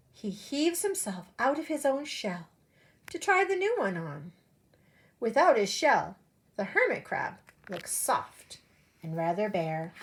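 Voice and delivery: lively storybook read-aloud voice; animated picture-book narration; reading to children. Acted. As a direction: read it like story time for little kids. Teacher reads picture book aloud.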